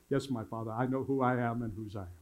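low, lightly mock German accent; imitated kids' German-accented reply. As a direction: recite the drill with a hint of German. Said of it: "saying it with a German accent".

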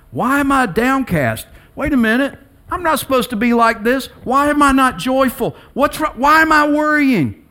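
high-pitched, urgent self-questioning; talking to himself. Acted. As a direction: act out someone taking his own soul to task. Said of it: "He's talking to himself".

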